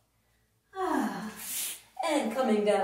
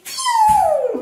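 much higher, louder cartoon zap; zippy pew sound effect; 11 dB louder than usual. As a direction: launch the jump with a whizzing sound. Frog jump sound effect.

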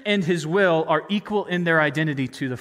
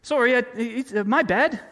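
higher-pitched mock Canadian apology; polite Canadian; 6 semitones higher than usual. Preacher imagines Jesus apologizing to the leaders as a polite Canadian. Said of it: "a polite Canadian and say".

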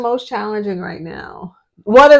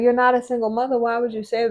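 mildly upset imitation; 4 semitones higher, faster than usual. Mother repeating critics of her single mommy remark, mildly upset imitation. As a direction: lightly quote the scolding, without pushing it. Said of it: "People kinda was upset because they were like".

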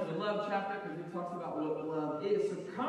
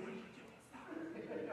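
hushed, laughing plea to stop; wife whispering to her husband to drop it; urgent whisper; 13 dB quieter than usual. Retold or imitated. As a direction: whisper it fast so only the driver hears. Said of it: "he's like".

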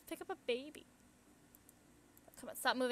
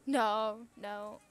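usual speaking voice

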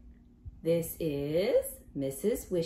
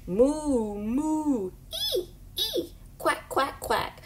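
higher moos, squeals and quacks; animal noises; 7 semitones higher, slower than usual. Acted. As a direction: sound off like a happy barnyard. Animals sound off happily.

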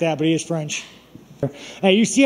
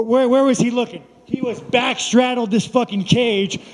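ordinary delivery

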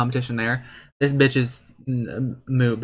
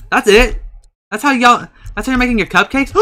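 shocked shout; 10 semitones higher, 7 dB louder than usual. Real, not acted. Shocked at cupcakes.

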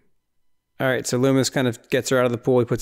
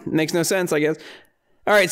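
usual speaking voice

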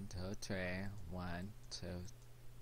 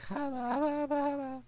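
very high, loud babble; squeaky mock child babble; pre-puberty voice; 6 dB louder, slower than usual. Gamer showing his voice before puberty, high squeaky babble. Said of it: "my voice was like".

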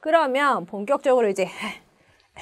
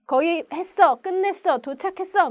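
raised-pitch relieved announcements; accomplished; 7 semitones higher, 3 dB louder than usual. Shown as a demonstration. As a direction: announce it like you just made it. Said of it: "막 이런 얘기 할 때". (해냈다는 기쁨으로)

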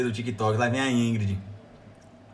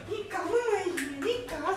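much higher, softer, slow cooing; high slow doting pet voice; 5 dB quieter, much slower than usual. Real, not acted. Baby-talk to the pet dog.

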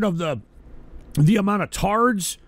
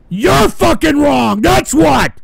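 angry shout; 3 semitones higher, 6 dB louder, slower than usual. Real, not acted. Streamer telling his chat they are wrong, angry shout. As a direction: hurl it at everyone listening.

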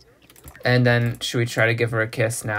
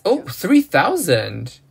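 high, louder gasp of amazement, drawn out; delighted surprise; 6 semitones higher, 4 dB louder, much slower than usual. Real, not acted. Gamer sees a painting sell high, delighted surprise. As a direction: marvel at an unexpected windfall, high and stretched.

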